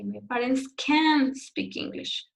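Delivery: negative "can" lifted in pitch and emphasized. Shown as a demonstration. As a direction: raise the voice on "can", then drop through "speak English". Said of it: "You raise your voice here".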